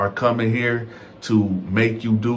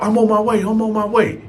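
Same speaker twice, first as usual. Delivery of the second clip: frantic; 10 semitones higher, faster than usual. Retold or imitated. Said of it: "he in a frantic".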